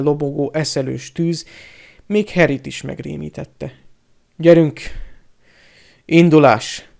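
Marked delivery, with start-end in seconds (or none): 4.4-6.9 loud, curt marching orders; barked command. A narrator's reading. Piton ordering the captives out, commanding bark. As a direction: order everyone to move at once. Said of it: "Parancsolta Piton".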